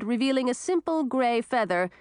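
plain reading voice

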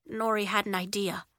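usual speaking voice

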